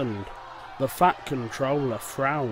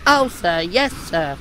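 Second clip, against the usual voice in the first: put-on higher, keen young engine voice; Percy eager to be picked; eager little engine; 3 semitones higher, 6 dB louder than usual. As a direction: jump at the chance.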